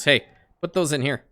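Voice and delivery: ordinary talk, nothing marked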